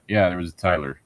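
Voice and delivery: ordinary delivery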